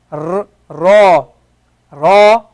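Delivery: "ra" with a tapped tongue-tip r; Arabic ra, tongue-tip tap; rolled Arabic ra. Shown as a demonstration. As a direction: flick the r off the tongue in "ra". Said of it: "ra comes off of the tongue".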